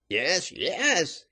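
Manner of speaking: high-pitched surrender; desperate cry. A narrator's reading. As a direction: give in with a sudden high cry. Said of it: "cried he at last".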